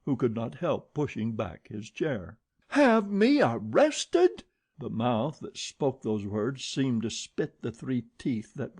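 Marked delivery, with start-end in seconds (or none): 2.7-4.4 high, loud, slow indignant echo; outraged. A narrator's reading. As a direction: spit it back in disbelief. Old woman outraged at threat.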